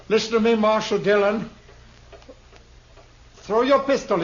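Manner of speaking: menacing demand. Acted. Gunman threatens the marshal.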